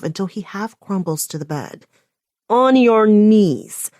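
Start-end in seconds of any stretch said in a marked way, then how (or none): 2.5-3.9 slow, loud, forceful order; demanding. Acted. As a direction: demand it, every word weighed. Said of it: "I demand again".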